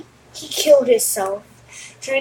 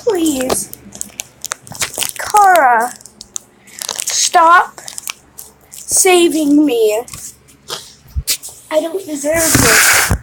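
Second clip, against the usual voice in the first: mild crying; 4 semitones higher, much slower than usual. Retold or imitated. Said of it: "he was crying and said".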